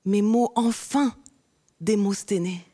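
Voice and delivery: rhythmic spoken-word delivery; slam poetry. Acted. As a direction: perform it like a slam poem. Performs her slam poem.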